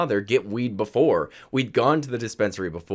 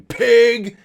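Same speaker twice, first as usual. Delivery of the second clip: high, loud, drawn-out mock scream; screamed insult; 9 semitones higher, 8 dB louder than usual. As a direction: holler it out the car window, imitating. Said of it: "roll the window down and scream".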